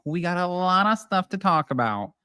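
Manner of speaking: suddenly nasal, a put-on Brady twang. Real, not acted. Vlogger to her viewers, accidentally nasal Brady voice. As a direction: slip into a nasal sitcom-mom voice. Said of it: "I don't know why I got so nasally".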